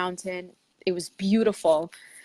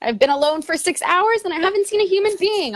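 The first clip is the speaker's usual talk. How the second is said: high, louder chatter to herself; cheery self-talk; 10 semitones higher, 4 dB louder than usual. Retold or imitated. Hiker alone for hours, chirpy high self-talk. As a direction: keep the chirpy talk to yourself going. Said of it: "kinda talking to myself".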